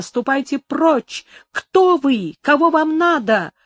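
frightened cry. Acted. A startled woman shouting in fear. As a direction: woken woman cries out at the dark stranger. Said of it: "вскричала она".

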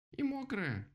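tiny, very high squeak; squeaking mouse. A narrator's reading. Mouse adds her remark, squeaking. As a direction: pipe it up like a mouse. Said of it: "пискнула мышка".

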